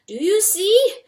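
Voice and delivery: raving, feverish fox voice; frantic fox. A narrator's reading. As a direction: press the mouse with mad urgency. Fox Russell raving to mouse.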